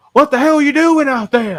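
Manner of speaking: high-pitched loud mock dad yell; dad impression. Son imitating his dad yelling from outside, hammed-up holler. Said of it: "Why did I make him sound like that?".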